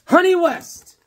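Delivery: high, loud excited shout of the title; excited announcement. Real, not acted. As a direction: announce it like a big reveal.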